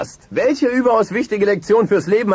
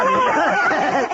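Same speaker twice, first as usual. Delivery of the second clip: big host laugh; 13 semitones higher than usual. Acted. Host laughs on game show.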